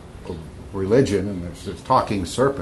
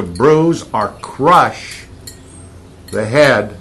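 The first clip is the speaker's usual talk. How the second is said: slow emphatic; 3 semitones higher, 6 dB louder, much slower than usual. Acted. Preacher stresses key words.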